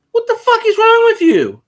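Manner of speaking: high, loud mock outrage; incredulous yell. Yelling at the Saints fan who loves Cam Newton, in loud disbelief. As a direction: let it fly up high in disbelief.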